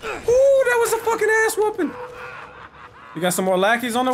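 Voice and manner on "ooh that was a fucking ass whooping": excited gloating. Real, not acted. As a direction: crow over the beating you just gave. Gloats after winning a fight.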